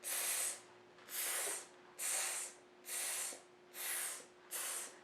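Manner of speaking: whispered "tss" hisses; monkey tss. Acted. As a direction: hiss "tss" like teasing monkeys. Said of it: "practice over and over that sound".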